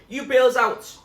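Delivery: high, loud shouted command. A narrator's reading. Zoe orders Munchkin over the storm, loud shout. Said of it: "shouted Zoe".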